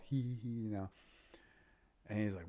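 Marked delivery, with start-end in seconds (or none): 0.0-0.6 high, louder fanboy giggle; giddy giggle. Retold or imitated. Gushing to his hero about watching him fight, a giddy giggle. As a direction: giggle like a starstruck kid. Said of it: "And I was all".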